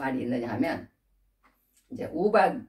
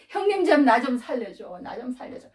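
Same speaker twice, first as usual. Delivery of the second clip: begging; 6 semitones higher than usual. Acted. Younger brother to his brothers who would sell him, begging. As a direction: beg for mercy, voice dropping away.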